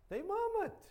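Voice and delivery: "murmured" squeaked way up high; mocking high murmured. Shown as a demonstration. Preacher mocking the complaining Israelites, high whine.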